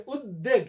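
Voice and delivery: উদ্বেগ said as ud-deg, no b; doubled d. Shown as a demonstration. Teacher showing students' faulty reasoning, doubled d. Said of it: "দ double হবে".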